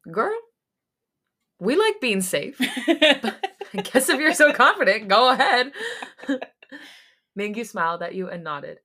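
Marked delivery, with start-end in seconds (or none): 0.1-6.4 laughing. Real, not acted. Laughing comment on the story.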